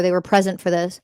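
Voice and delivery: normal talking voice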